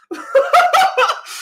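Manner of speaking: loud, high laughter; real uproarious laugh. Real, not acted. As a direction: burst out laughing. Cracks up at being clowned.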